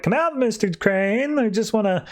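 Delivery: mock calling out. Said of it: "Dick's just calling out".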